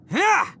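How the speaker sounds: high sharp attack cry; battle yell. Acted. Hero striking the villain, sharp battle yell. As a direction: throw the strike with a shout.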